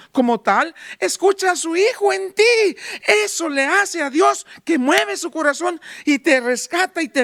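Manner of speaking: loud, high preaching surge; full-force sermon voice; fiery preaching. Acted. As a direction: preach it out to the congregation.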